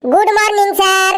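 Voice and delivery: schoolboy chorus greeting; high greeting. Acted. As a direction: chirp the greeting up high like a pupil. Class greets the teacher.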